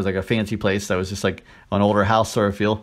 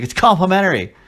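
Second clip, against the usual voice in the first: mimicking the wife; 8 semitones higher, 4 dB louder than usual. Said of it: "she's like".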